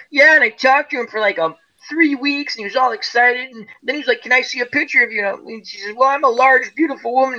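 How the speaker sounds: mild mimicry of the coworker's story; imitating Verda. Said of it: "So she's going".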